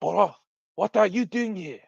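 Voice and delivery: put-on hammy French accent, demanding an answer; hissed French accent. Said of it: "then in a hiss".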